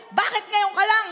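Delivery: put-on high, loud scolding of a late child; mock strict parent scolding. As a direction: scold the kid who came home late. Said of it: "sasabihin niya".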